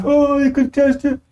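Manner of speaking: high, slow mock wail; mock objector. Preacher contrasting two attitudes for listeners, mock wailing. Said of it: "It's two different attitudes there".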